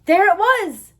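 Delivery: loud, high, joyful reveal; excited. A narrator's reading. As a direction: burst out with delight. Purr finally found.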